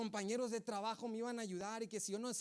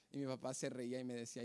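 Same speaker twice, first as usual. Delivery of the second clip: ordinary delivery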